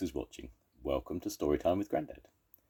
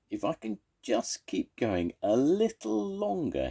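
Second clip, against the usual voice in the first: breathless, higher toad voice; panting; 12 semitones higher than usual. Acted. Tired toad panting on his way home. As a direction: push the words out between breaths. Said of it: "he panted".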